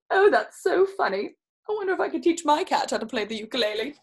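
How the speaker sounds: plain reading voice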